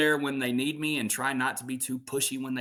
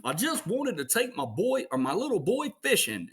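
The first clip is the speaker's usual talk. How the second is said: higher, hammed-up Goofy dad; dad sulking over a lost fishing trip, higher cartoon voice; 9 semitones higher than usual. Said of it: "And Max is like".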